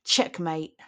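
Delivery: usual speaking voice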